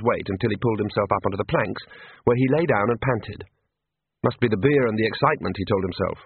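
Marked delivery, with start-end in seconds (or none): none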